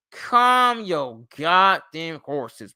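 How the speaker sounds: slow, emphatic telling-off; drawn-out, fed-up scolding; exasperated retort. Acted. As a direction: drag out every word in exasperation. Repeats retort to Phenom.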